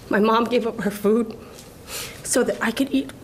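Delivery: real emotion, voice dropping away to a whisper; choked up. Real, not acted. Award winner recalling her mother's sacrifice, choked up. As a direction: fight back tears, pausing between phrases. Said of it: "I'm sorry that I'm emotional".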